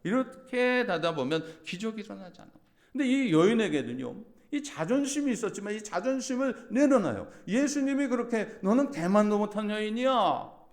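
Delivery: high, loud preaching climb; full-force preaching. Acted. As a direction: drive the point home to the congregation. Preaching peak. (고조된 설교 톤)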